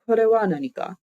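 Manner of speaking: Kore wa nani ka with the voice going up at the end; rising question tune. Shown as a demonstration. Teacher showing a question in Japanese, voice rising at the end. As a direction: lift the pitch on the last words of "Kore wa nani ka". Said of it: "voice kind of goes up at the end".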